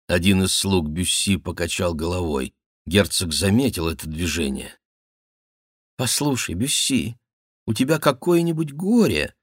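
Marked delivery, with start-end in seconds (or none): 6.0-9.3 high, coaxing question; almost ingratiating. A narrator's reading. Duke asks Bussy about his grief, almost fawning. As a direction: wheedle gently, pitched high. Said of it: "спросил он почти заискивающим тоном".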